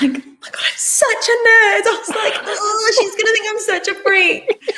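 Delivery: laughing high shriek of embarrassment; high panicked squeal. Retold or imitated. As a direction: squeal it like a nervous nerd. Panicking inner voice.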